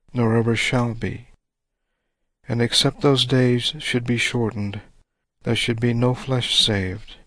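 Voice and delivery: usual speaking voice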